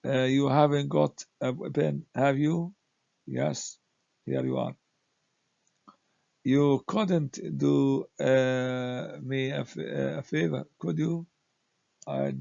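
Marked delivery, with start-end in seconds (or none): 0.0-2.7 "have you?" rises at the end, making a request; rising question tag. Shown as a demonstration. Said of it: "The voice goes up". 6.4-11.3 "could you?" goes up, asking a favor; rising question tag. Shown as a demonstration. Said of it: "The voice goes up".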